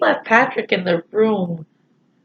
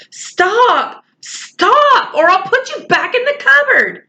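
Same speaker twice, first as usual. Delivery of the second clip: much higher, urgent yell to stop him; 13 semitones higher, slower than usual. Acted. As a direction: cry out to halt a chopping warrior. Omri yells at axe-swinging Little Bear.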